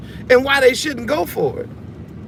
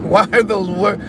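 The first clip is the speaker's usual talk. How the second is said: ordinary talk, nothing marked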